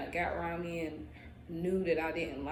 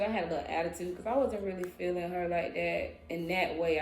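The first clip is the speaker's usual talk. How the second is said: normal talking voice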